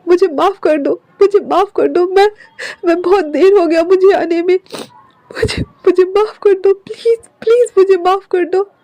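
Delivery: Wing weeping in his arms; crying. Acted. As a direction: beg forgiveness while crying. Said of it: "wing रोते हुए".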